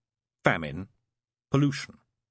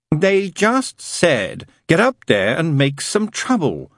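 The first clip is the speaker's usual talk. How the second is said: lightly voiced serpent, pitched higher; a raised character voice, telling it plainly; 8 semitones higher, 8 dB louder, slower than usual. Serpent explaining his job, lightly voiced.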